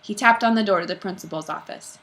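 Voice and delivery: plain reading voice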